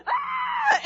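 very high short scream; high startled shriek; retold scream. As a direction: yelp and leap back. Spider charges, they scream.

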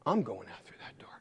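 low, hushed thinking aloud; secret scheming. Acted. Brother planning escape through the door, hushed resolve. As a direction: drop to near whisper, plotting. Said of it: "He figures".

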